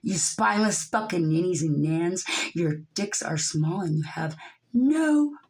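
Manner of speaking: loud, slow furious tirade at the powerful; angry rant. Real, not acted. Furious vlogger screams insults at the one percent. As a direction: hurl every insult with full rage.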